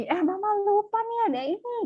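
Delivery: high, airy put-on mom voice; mock forgetful mom. Mother breaking a promise. (ibu pura-pura lupa, suara tinggi)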